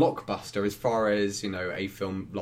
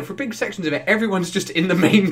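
usual speaking voice

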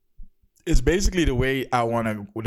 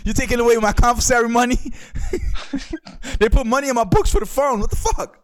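mock inmate voice; 12 semitones higher than usual. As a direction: yelp it like an inmate on the phone. Playing inmate on phone, high.

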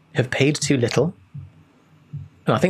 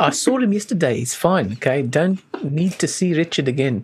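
put-on higher South African accent; 4 semitones higher than usual. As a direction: play the South African golfer. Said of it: "South African accent".

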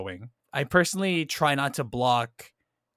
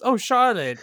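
higher, louder mock Tim reply; imitating Tim; 10 semitones higher, 7 dB louder, slower than usual. Retelling the film, voicing Tim's reply to Charlotte. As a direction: toss back a bright awkward hello. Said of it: "Tim goes".

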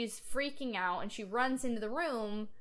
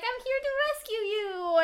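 put-on much higher, louder small-girl voice; little girl rescuer; 13 semitones higher, 6 dB louder than usual. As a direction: play the brave little daughter bursting in. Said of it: "and she's like".

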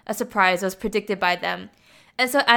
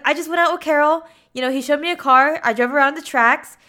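louder, mildly flustered retold excuse; raised-voice mock defence; defensive explaining; 5 semitones higher, 5 dB louder than usual. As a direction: act out a husband justifying himself. Husband defends himself in acted quarrel.

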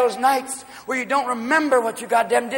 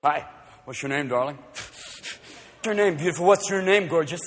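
put-on drunk pickup guy; 3 semitones lower, 5 dB quieter, slower than usual. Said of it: "They can walk up to you and go".

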